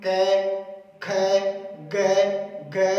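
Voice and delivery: each letter from कै to गे sounded out separately and clearly; demonstrating sounds. Shown as a demonstration. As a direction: sound out each consonant for the class. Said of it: "कै का उच्चारण कर रहे हैं हम".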